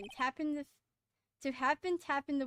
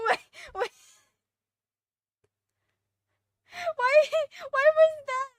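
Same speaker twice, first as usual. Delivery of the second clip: high, loud words through laughter; real laughing fit; 13 semitones higher, 11 dB louder than usual. Real, not acted. Player baffled by the game, loud and high through laughter. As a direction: squeal it out while cracking up.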